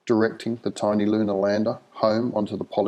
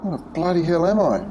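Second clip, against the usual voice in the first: bewildered; 9 semitones higher, faster than usual. Acted. Dazed prisoner wakes.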